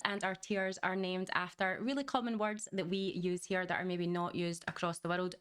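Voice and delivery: ordinary talk, nothing marked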